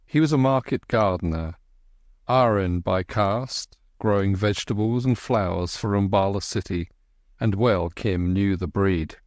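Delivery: ordinary delivery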